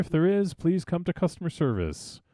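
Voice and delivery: high customer service announcement; mock store PA page. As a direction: call it out over the store speakers. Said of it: "page you on the speaker".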